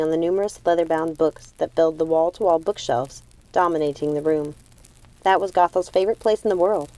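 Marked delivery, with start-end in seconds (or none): none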